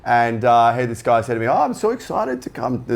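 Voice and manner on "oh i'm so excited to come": high-pitched mock Brazilian accent; bad Brazilian accent. Musician imitating the fan overheard in the toilet, bad Brazilian accent. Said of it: "trying to do a bad Brazilian accent".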